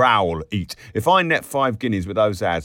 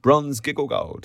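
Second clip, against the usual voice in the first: plain reading voice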